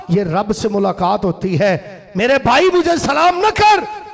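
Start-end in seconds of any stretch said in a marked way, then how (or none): 2.2-4.0 high, loud, drawn-out plea; preacher voicing a worshipper warding off greetings; impassioned refusal. (ऊँची आवाज़ में जोशीली मनाही)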